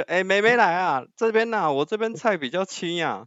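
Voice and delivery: high, loud hawker's call; market vendor calling. Retold or imitated. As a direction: call out like a stall vendor hustling buyers. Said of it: "这个妹妹这声叫出来".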